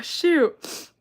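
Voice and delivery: ordinary talk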